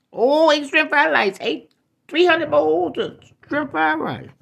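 the Chinese man in the joke, in a high mock Chinese accent. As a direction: ham up a Chinese accent, high and sing-song. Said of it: "Asked Chinese man".

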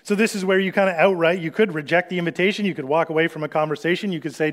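ordinary talk

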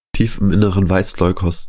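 usual speaking voice

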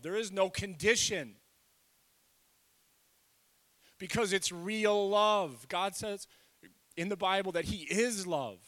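loud, high, impassioned preaching with stretched key words; full-force preaching. Real, not acted. Preacher urging listeners that they are loved, full-force preaching. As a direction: preach it out loud, slow and hard on the big words.

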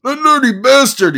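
high, loud anguished shout; enraged screaming attorney. Acted. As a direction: bellow it at the wall. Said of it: "he shouted".

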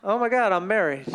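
low, sinking mock dismay; deflated. Married person realizing what happened, deflated. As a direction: let the voice drop as reality lands. Acting the letdown after the wedding.

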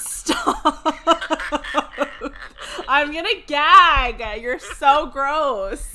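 high, giggling, slow groan of disgust; laughing disgust. Real, not acted. Daughter grossed out by her dad's smooth voice. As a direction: laugh and gag at him.

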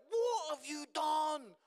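high, loud, incredulous friends; mock friends shouting. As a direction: shout it like shocked mates. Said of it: "all my friends were like".

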